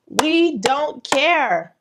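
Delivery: each word drawn out and weighted; slow emphatic point. Real, not acted. Author bluntly tells writers readers don't care, slow and emphatic. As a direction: lay it down slowly, word by word.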